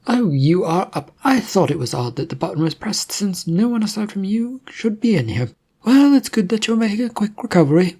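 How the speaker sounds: a cheerful nurse who takes charge; brisk nurse. Acted.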